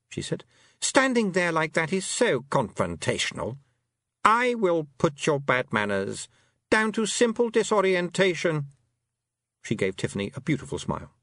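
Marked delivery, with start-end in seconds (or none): 0.8-8.7 affronted fairy queen. A narrator's reading. A fairy queen affronted by a rude girl. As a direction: act scandalised, icy sweet.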